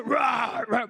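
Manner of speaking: short rough mock roar; lion roar. Preacher imitates the hungry lion under the cable, roaring. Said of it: "Talagang yung sumisigaw".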